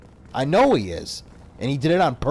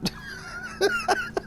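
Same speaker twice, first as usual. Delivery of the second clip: high squealing laugh; 5 dB quieter than usual. Real, not acted. Laughing at own impression.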